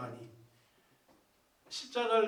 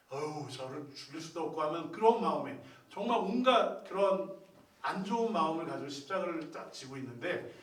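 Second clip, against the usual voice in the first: ordinary delivery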